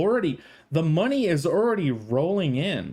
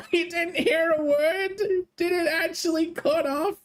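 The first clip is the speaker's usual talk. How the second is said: laughing; 13 semitones higher than usual. Real, not acted. Laughing, high reaction.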